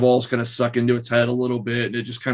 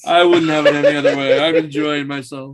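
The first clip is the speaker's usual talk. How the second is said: laughing; 4 semitones higher, 6 dB louder than usual. Real, not acted. Laughing, pleased DM.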